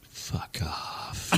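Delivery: breathy, unvoiced low mutter of fuck off; muttered insult. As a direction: exhale it flatly like smoke. Woman smoking, muttered insult.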